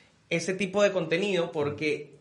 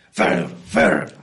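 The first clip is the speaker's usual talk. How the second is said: [name] growl; 13 semitones lower, 4 dB louder than usual. Retold or imitated. Imitating [name] singing.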